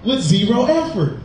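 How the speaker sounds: high, punchy TV pitchman; infomercial voice. Pretend. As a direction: sell it like a late-night ad. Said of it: "That's my infomercial voice".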